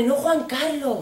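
high, mock-anxious string of objections; hammed-up worried refusal; panicky mother. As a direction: protest like a worried girlfriend, piling on excuses. Mother acted, anxiously refusing father.

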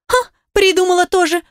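высоко, с насмешкой; фыркнуть с издёвкой; усмешка. A narrator's reading. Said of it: "усмехнулась Аня". (насмешливо, с усмешкой)

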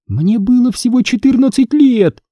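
put-on high, eerie girl's voice from the speakers; a dead girl's gloating threat; ghost girl. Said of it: "послышался голос из динамиков". (голос мёртвой девочки)

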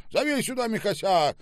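loud, high-pitched command; angry order. Acted. Boss demands the manager be fetched, angry order. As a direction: yell the order at the waiter.

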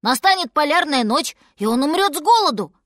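put-on alarmed child pleading a case; worried bear cub.

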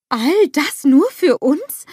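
slow, high incredulous question; disbelieving. A narrator's reading. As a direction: ask slowly, as if unable to believe it. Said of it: "fragt Gerda ungläubig".

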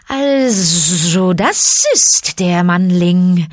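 loud, slow, long buzzing sibilants; hissing snake. A narrator's reading. Python appraises the boy before him, hissing. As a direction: draw out every s, sizing someone up. Kaa the python inspects Mowgli.

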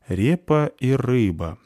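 slow, musing repeat; pensive. A narrator's reading. Polchik mulls over the girl's order, pensive. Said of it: "задумчиво повторил он".

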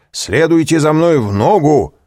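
громкая высокая команда; скомандуй во весь голос; командный крик. A narrator's reading. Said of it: "крикнул унтер-офицер". (громкая команда)

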